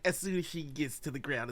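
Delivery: usual speaking voice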